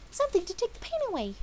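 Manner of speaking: high, quick chirpy voice; Pinkie informing. A narrator's reading. Pinkie explaining the needle to Dash, high chirpy. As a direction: say it sweetly. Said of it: "she informed".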